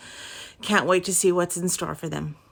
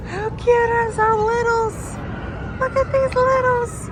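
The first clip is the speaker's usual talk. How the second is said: cooing; 6 dB louder, slower than usual. Real, not acted. Cooing over the little ones.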